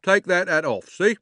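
loud, stern order. A narrator's reading. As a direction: give a firm command. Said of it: "said the policeman sternly".

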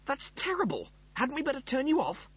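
high-pitched dawning alarm. Acted. Rimmer realising the computer is dying, alarmed. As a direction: react in high, worried dismay.

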